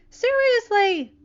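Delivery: high, loud, slow disbelief; incredulous. Acted. MJ doubting the weekend story, incredulous. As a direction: stretch it out in disbelief. MJ surprised.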